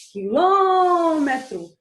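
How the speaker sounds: "Quilômetro" with a long, loud "lô"; stretched stressed syllable. Shown as a demonstration. Teacher introducing proparoxítonas, "lô" of "Quilômetro" drawn out. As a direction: swell and hold the "lô" in "Quilômetro". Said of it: "vai ser onde eu passo mais tempo".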